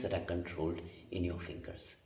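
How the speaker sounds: soft unvoiced whisper; hushed aside to the viewer. Real, not acted. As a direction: whisper it like a secret. Drops to a whisper.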